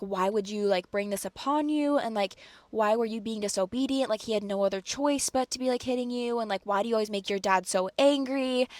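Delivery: normal talking voice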